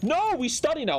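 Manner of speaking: a hint of a strict mother; firm mom. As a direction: lay down the law as mom. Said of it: "She's like".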